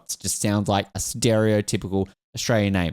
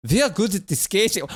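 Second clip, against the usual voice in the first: higher-pitched attempt at Dutch turned Russian; accidental Russian accent; 7 semitones higher than usual. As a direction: slip into a mock Russian accent. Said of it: "Why are they now Russian?".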